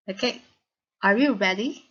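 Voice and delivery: ordinary talk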